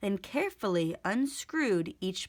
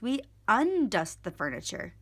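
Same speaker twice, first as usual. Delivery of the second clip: ordinary delivery, nothing marked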